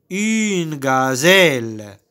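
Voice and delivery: "Une gazelle" drawn out slowly, every syllable stretched; slow model word. Shown as a demonstration. Teacher modelling "Une gazelle" slowly for pupils to repeat. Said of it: "C'est une gazelle".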